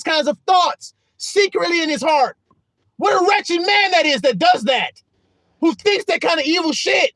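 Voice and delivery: high, loud impassioned self-reproach; anguished outcry. Acted. Paul lamenting his sinful nature, anguished outcry. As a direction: cry out in torment, pleading for deliverance. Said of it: "I'm quoting it with the, the energy that matches it".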